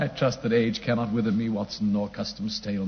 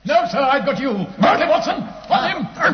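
shout; 9 dB louder than usual. Acted. Holmes pounces, shouting orders.

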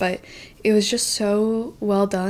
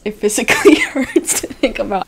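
louder, laughing through the words; 4 semitones higher, 8 dB louder than usual. Real, not acted. Reliving the embarrassment, laughing it off. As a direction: let the laugh break in.

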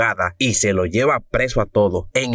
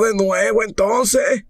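put-on old man voice; 11 semitones higher, slower than usual. Viejo propone a la vieja.

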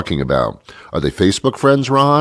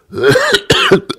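sudden harsh cough; real cough from a head cold; sick cough; 3 dB louder than usual. Real, not acted. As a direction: hack out one chesty cough. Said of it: "did you hear that".

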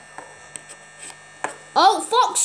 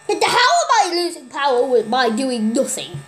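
usual speaking voice

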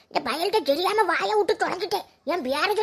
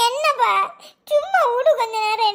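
sobbing; 3 semitones higher than usual. Acted. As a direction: push them away through sobs. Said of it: "ஏங்கி ஏங்கி அழுதுகிட்டு இருக்க".

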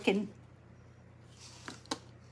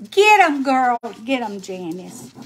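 cheering on; 7 dB louder than usual. Real, not acted. Streamer cheers a friend on, loud cheering. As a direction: whoop it out to a friend.

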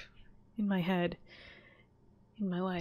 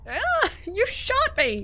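much higher and louder, drawn-out cry of pain; pained yelp; 13 semitones higher, 8 dB louder, much slower than usual. Acted. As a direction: yelp as if just hit by a bullet. Getting shot in game.

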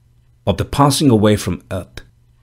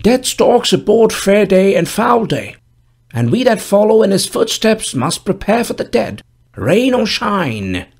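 put-on old gravedigger voice; 11 semitones higher than usual. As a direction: speak as a dour old gravedigger, raised pitch. Gravedigger speaks in story.

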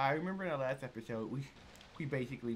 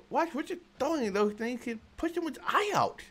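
much higher, prim scolding voice; scolding character voice; 12 semitones higher, 3 dB louder than usual. Acted. Player voicing a character telling off the kid, high and scolding.

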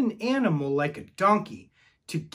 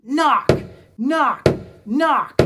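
high, loud, separate punched words; louder knocks; 5 semitones higher, 7 dB louder, slower than usual. Shown as a demonstration. Getting children to knock again louder, loud. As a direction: call out each knock loudly.

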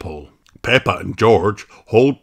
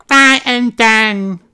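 put-on slow, much higher baby voice, a bit louder; a tiny piglet echoing a word; toddler George; 7 dB louder, slower than usual. A narrator's reading. George echoes his sister, slow high toddler voice. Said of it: "says George".

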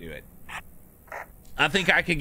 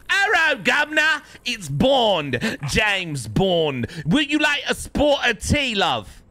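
loud, high-pitched, hammed-up British accent; 10 semitones higher, 5 dB louder, slower than usual. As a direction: belt out a cartoonish British "guv'nor" accent. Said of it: "No American can do the accent well enough".

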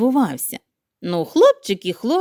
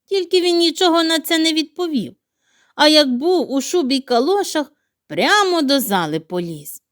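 usual speaking voice

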